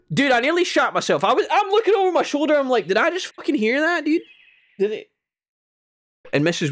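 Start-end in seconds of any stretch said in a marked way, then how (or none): 0.1-5.1 amazed disbelief. Real, not acted. Stunned by diner conversation.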